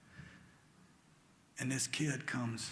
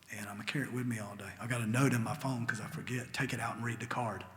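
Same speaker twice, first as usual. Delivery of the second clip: usual speaking voice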